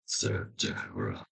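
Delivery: breathy low whisper, slow; crowd whisper. Acted. Whispering voices rise from crowd.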